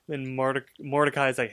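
ordinary delivery, nothing marked

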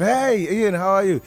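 imitated friendly greeting. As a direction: voice the other man's greeting, lifted. Voicing Dennis's casual greeting.

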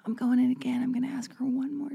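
hushed, conspiratorial whisper; mock secret plan whispered; sneaky whisper. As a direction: whisper it like a plotting schemer. Retelling own sneaky resolve.

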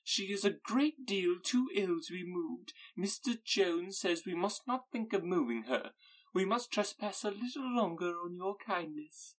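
a high, anxious, gushing matron; fussy Mrs Bennet voice. Acted.

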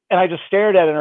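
normal talking voice

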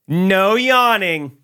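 high, loud, very slow "No yawning"; the chipper gate agent forbidding a yawn, high and slow; mock agent. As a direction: draw it out as a sassy order.

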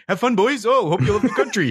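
high, friendly mock welcome, laughing through it; cheery Canadian border guard. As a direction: play the overly nice border guard waving them in. Friendly Canadian customs officer.

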